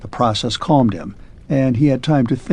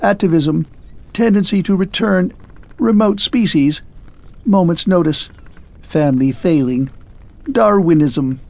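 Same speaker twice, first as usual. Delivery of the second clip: higher, jerky mechanical man; a hint of a clockwork man, higher; clipped clockwork man; 6 semitones higher than usual. A narrator's reading. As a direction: rattle it off like a faulty machine.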